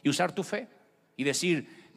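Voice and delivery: ordinary delivery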